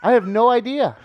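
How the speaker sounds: high, loud excited protest. Real, not acted. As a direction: insist eagerly, laughing. Excited denial amid laughter.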